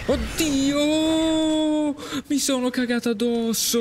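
real high-pitched fright; startled scream. Real, not acted. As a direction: yelp as if jumped at. Jump scare by enemy.

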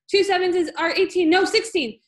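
high, loud flustered answer; frantic blurting. A narrator's reading. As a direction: scramble loudly for the right answer. Pupil blurting wrong answers under threat.

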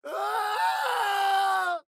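very high straining shout; piercing battle cry; shout of effort. Acted. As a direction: cry out in exertion. Effortful cry while casting.

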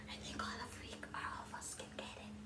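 playful secret whisper. Acted. Teacher whispering to her class that all can do it.

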